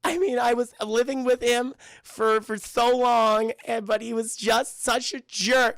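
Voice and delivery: high, slow, whiny crying rant; hammed-up weepy confession; mock tearful breakdown. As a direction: draw the words out as if blubbering. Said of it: "she starts breaking down in tears".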